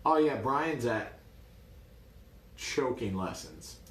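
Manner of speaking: slow, drawn-out snide jab. Retold or imitated. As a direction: imitate a father's snide dismissal. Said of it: "He would snidely say".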